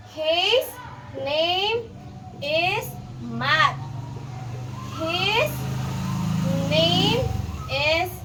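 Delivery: each word spaced with pauses; slow school dictation. Shown as a demonstration. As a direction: model the sentence word by word. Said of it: "Babasahon ko through".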